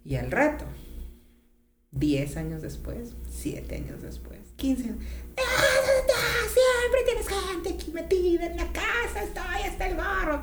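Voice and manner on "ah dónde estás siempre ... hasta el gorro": high, whiny, exasperated nagging; hammed-up high-pitched griping; mock fed-up complaint. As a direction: whine it high like a partner who has had enough. Imitating a fed-up partner complaining.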